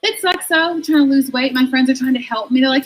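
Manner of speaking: ordinary talk, nothing marked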